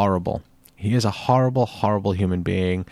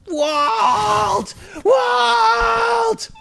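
anguished cry. Pretend. Sign-off gag, crying out.

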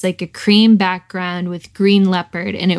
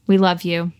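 ordinary delivery

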